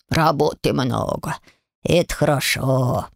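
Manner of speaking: low, slow little-spirit voices; two brothers asking in unison; lightly voiced house spirits. A narrator's reading. House spirits asking the girl to feed them, low and slow.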